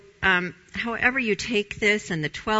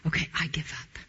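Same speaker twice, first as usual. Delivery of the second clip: breathless give-up whisper, softer; defeated whisper; 5 dB quieter, faster than usual. Retold or imitated. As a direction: whisper the surrender. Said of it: "where you say".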